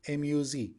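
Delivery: "M U Z" letter by letter; name each letter of "M U Z" separately; spelled out. Shown as a demonstration. Teacher spelling banana in Turkish, "M U Z" letter by letter.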